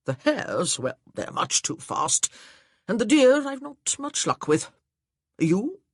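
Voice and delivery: a jovial, booming suitor; cheerful gentleman. Acted. Beckington chatting hunting.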